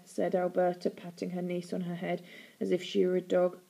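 ordinary delivery, nothing marked

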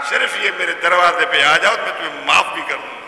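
ordinary talk

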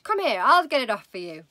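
loud, impatient wife; wife's voice. Acted. Wife grabbing husband's stuck hat, loud and bossy. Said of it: "your wife said".

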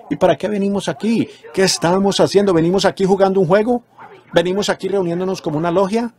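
high-pitched rhetorical questioning; challenging preacher's questions. Real, not acted. (preguntas retóricas de predicador, en tono agudo)

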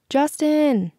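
high-pitched call across the room; summoning a friend at a party; mild shout. A narrator's reading. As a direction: call him over, high but not very loud. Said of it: "Vanessa shouted".

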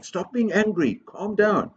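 high-pitched mock telling-off; scolding command. Shown as a demonstration. Teacher showing children what not to say, high mock scolding. Models the wrong thing to say.

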